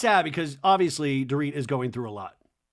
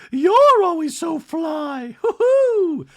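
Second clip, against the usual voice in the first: much higher, louder, slow squeal; a shrieking Dorit, hammed up; mock Dorit; 11 semitones higher, 9 dB louder, much slower than usual. Host mimicking Dorit's gushing lunch greeting, squealed. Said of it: "And Dorit's like".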